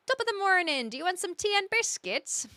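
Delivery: high-pitched put-on Irish lilt; Irish accent. Streamer trying out an Irish accent for chat, playful Irish lilt. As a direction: chirp it as a stock Irish greeting. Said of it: "you want to learn the Irish accent".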